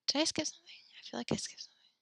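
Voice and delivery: whispered aside to herself; soft unvoiced whisper. Real, not acted. Teacher mutters to herself.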